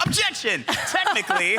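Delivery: high, laughing courtroom objection; mock lawyer objection. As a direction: cry objection like a kid lawyer.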